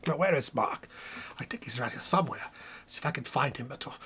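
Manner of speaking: quick hushed whisper. Real, not acted. Looks for Mark, whispering.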